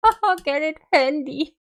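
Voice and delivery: laughing. Real, not acted. Laughing at own pun.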